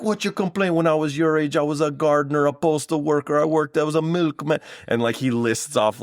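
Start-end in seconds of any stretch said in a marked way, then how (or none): 0.0-4.6 much higher mock Jamaican dad listing all his jobs; brag-lecture the son in an over-the-top Jamaican accent; terrible Jamaican accent. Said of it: "forgive him the terrible Jamaican accent".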